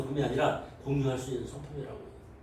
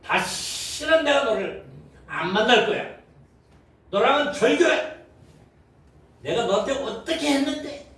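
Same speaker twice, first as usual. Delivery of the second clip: high, hurt and indignant, louder; mock angry breakup; 8 semitones higher, 4 dB louder than usual. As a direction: throw the friendship away in a hammed-up huff. Acted friend cutting ties.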